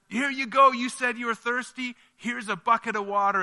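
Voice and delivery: high, loud and eager offering; eager soldiers. Acted. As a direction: hand it over proudly, voice up high. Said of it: "and they're like".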